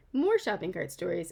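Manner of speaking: plain reading voice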